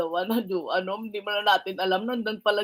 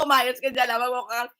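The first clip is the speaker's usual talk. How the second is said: usual speaking voice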